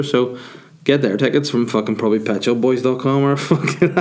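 ordinary delivery

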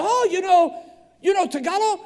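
high, loud imitation of an excited woman; delighted mock surprise. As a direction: exclaim it as an astonished stranger. Retold lady's delighted surprise.

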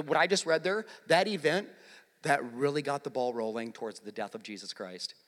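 ordinary talk

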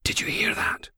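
hushed, breathless whisper. A narrator's reading. Logan on the dark stairs asks Steele, whispering. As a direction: whisper it, afraid of being heard.

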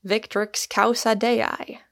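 usual speaking voice

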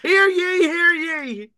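very high, loud proclamation voice; town crier. Pretend. Announcing the king like a crier.

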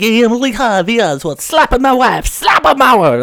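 high, loud, hammed-up Borat accent; Borat impression. Oswald as Borat, loud hammed-up Borat accent. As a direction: belt it out like Borat. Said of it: "That's Borat".

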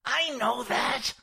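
slow, forceful stage whisper; exasperated hiss. Acted. Jack answering George in the washroom, exasperated hiss. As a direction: hiss back, pressing on each word.

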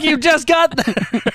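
high, slow mock disbelief; exaggerated reply to a wailing friend; incredulous. Retold reply to sobbing friend.

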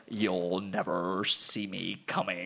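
low, slow menacing villain voice with a laugh in it. Retold or imitated. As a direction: drop into the sinister villain, slowly. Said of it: "do the voice".

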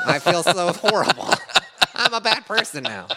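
high, loud guilty laughter; laughing. Real, not acted.

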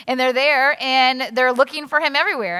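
ordinary talk, nothing marked

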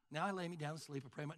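fast, low, soft rote mumble; wimpy praying. Acted. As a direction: rattle off a bedtime prayer. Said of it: "it's not wimpy praying".

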